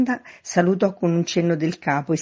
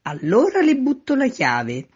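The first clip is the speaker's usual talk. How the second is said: high, raised calling voice; shouted offer; 10 semitones higher, 5 dB louder than usual. A narrator's reading. Man at window offers to throw the key, calling out. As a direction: call it down to the street. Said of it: "sempre gridando".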